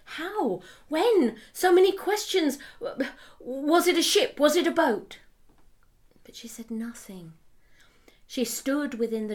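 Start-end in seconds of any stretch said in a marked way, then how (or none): 0.0-5.2 high, urgent string of questions; anxious questioning. Acted. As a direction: press her with worried questions. Old man's flood of anxious questions.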